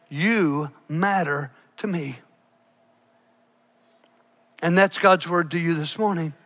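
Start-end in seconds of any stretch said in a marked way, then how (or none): 0.1-2.2 lower, softer and slow, full of love; tender assurance. Retold or imitated. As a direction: speak it gently, like God comforting a hurt boy. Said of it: "God came up alongside him and said".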